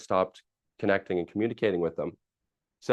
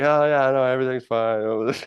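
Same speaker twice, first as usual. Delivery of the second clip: mock breezy reassurance; 5 semitones higher, 5 dB louder than usual. Brushing off Don's concern.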